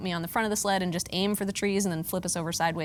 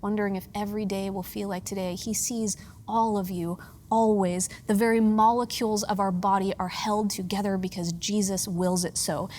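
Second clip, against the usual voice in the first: ordinary talk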